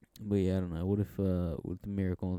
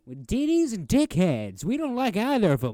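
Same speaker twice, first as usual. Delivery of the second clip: loud, high, hammed-up barman; tough guy bartender; 12 semitones higher, 10 dB louder than usual. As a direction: belt it out as a mock movie tough guy. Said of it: "the tough guy bartender".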